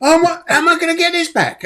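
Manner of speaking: high-pitched, helpless protest; mock defeated. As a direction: imitate a debt collector at a loss. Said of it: "felt so defeated".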